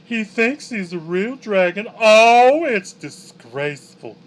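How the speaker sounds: slow, high catty gossip; gossipy monster voice. Acted. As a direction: dish the dirt like a drag queen monster. Flump gossips about pseudo dragon.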